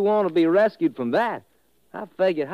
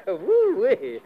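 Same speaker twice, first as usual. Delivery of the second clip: gleeful whoop; 3 semitones higher than usual. Acted. Rescuer whoops after the fight.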